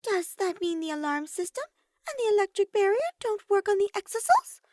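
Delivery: put-on high girlish voice, inquisitive; a high, curious girl asking; Sumugi's voice. A narrator's reading. Sumugi asks K1-B0 about the barrier, high and curious.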